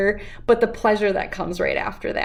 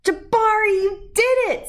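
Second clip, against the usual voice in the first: much higher, bright proud cheer; 11 semitones higher than usual. Acted. Dad cheering Jabari after his first jump, excited. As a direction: cheer him on, thrilled.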